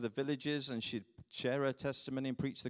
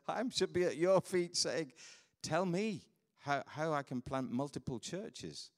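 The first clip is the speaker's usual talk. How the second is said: slow, higher-pitched mock entreaty; retold humbled plea; 5 semitones higher than usual. Pastor asks the young planter to teach him, retold humbled plea. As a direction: draw out the retold plea, earnestly.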